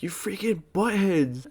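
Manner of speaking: high, drawn-out mock outrage; exasperated insult. Scolds cousins after the prank.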